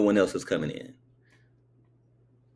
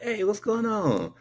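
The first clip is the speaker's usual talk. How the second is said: higher, slow laid-back hello; a slow, much higher voiced greeting; high casual greeting; 13 semitones higher than usual. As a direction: call out an easy hey from the car window. Greeting the two guys at the store.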